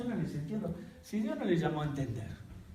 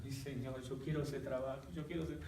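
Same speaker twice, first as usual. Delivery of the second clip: soft, hushed pleading, much softer; whiny plea; 11 dB quieter than usual. Retold or imitated. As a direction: beg quietly like a stubborn child. Pleading with God.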